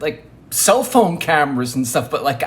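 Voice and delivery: ordinary delivery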